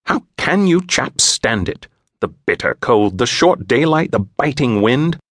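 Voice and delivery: a put-upon English gent griping; complaining English stranger. A narrator's reading.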